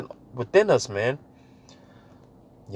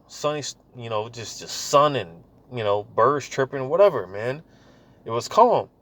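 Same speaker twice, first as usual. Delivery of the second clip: ordinary delivery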